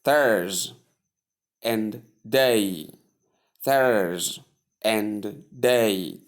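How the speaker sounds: Thurs, and day, with pauses between; syllable by syllable. Shown as a demonstration. Teacher leading learners through Thursday, syllable by syllable. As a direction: separate Thurs from day, each drawn out. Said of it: "there are two syllables here".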